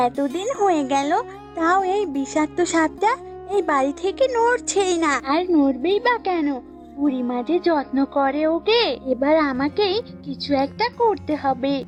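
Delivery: lightly voiced resentful goat; scheming goat. Goat alone plotting against the snake, mildly resentful. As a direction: grumble your way into a plan.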